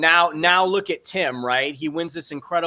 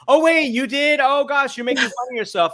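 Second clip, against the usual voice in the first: higher, louder, delighted surprise; surprised realization; 10 semitones higher, 5 dB louder than usual. Retold or imitated. A viewer realizing the host laughs at herself, in high surprised delight. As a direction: act out a viewer suddenly catching on, pitched high. Viewer discovering a blooper, quoted.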